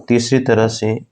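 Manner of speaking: normal talking voice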